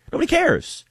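squeaky high mock dismissal; sarcastic brush-off, voice jumping up high. Real, not acted. Mocking the gatekeepers.

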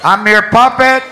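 put-on loud, slow intercom dedication in a Chicano accent; Chicano dedication shout-out. The announcer dedicates a song to Gloria over the intercom, Chicano accent. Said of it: "they do shout-outs".

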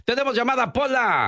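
tono agudo y cantarín, bien arriba; anúnciala cantando, alargando; llamada cantada. Acted. Locutora llama a su compañera. (locutora llamando a Pola, tono cantado y agudo)

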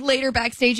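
usual speaking voice

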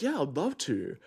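high-pitched, keen mate's yes; imitated eager agreement. Mate naively accepting a stranger's invitation, imitated eager agreement. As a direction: lightly mimic a friend happily going along.

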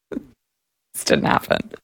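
breathy whispered disbelief; whispered aside. Real, not acted. Host's whispered doubt to the audience. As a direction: hush it like a secret. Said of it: "aside disbelief".

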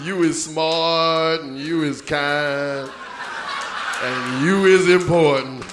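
slow, low, tender maid voice; The Help quote. Retold or imitated. Quoting The Help's maid, slow and low. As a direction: linger on every word like a nanny. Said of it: "the movie The Help".

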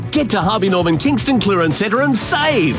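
ordinary talk

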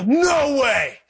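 laughing, high-pitched mock shout of disbelief; a laughing friend stunned to see an old classmate in heaven; astonished disbelief. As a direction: shout it like you can't believe who made it. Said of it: "turn around and go".

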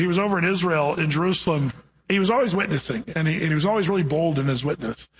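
ordinary talk, nothing marked

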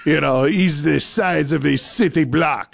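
hammed-up French accent; terrible French accent. French tourist marvelling at the size of Costco, terrible French accent. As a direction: badly imitate an awestruck French visitor. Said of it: "now that's a terrible French accent".

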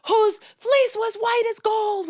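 put-on high, drawn-out chant of shouting kids; mock screaming. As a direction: imitate overexcited children screaming a rhyme. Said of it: "We were screaming".

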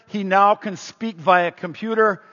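ordinary delivery

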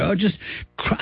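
normal talking voice

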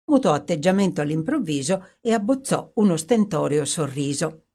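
usual speaking voice

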